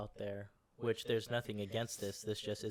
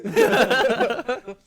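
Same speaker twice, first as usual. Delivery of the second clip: laughing; 11 semitones higher, 17 dB louder than usual. Pretend. Laughing joke call.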